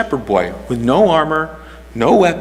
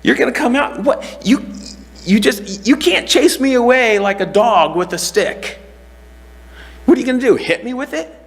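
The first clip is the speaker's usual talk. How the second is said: insulted giant; 11 semitones higher than usual. Retold or imitated. Goliath mocking the shepherd boy, high and scornful. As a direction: sneer down at a boy with a stick. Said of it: "he's insulted".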